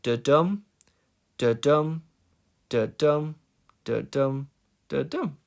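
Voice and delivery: five separate "da-dum" beats, DUM stressed; rhythm demo. Shown as a demonstration. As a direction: point out each "da-dum", pausing between. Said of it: "I'll do it with the pointer".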